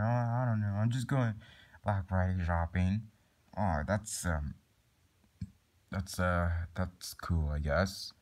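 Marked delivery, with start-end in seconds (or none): none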